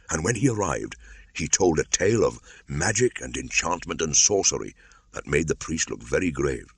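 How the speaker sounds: usual speaking voice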